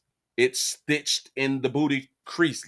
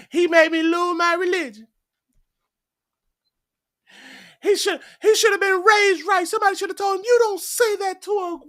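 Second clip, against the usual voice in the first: high, louder woman's voice complaining; mock indignant woman; 3 dB louder than usual. Woman blaming a man for her lost religion, high and indignant. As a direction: ham up a wronged woman, pitched high.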